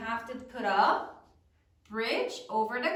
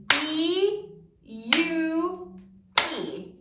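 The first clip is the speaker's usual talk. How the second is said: very slow, every sound stretched out; over-pronounce "B U T" so each sound is heard; exaggerated syllables; 4 semitones higher, 4 dB louder, much slower than usual. Shown as a demonstration. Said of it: "we're gonna exaggerate it so you can hear every sound".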